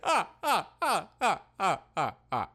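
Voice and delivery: laughter fading down from high; forced laugh. Acted. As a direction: laugh that dies out. Laughing at skeleton pun.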